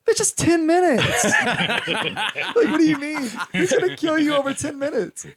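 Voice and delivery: mock outrage through laughter; laughing disbelief. Mocking the girl's excuse in the movie, laughing disbelief. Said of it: "I'm like".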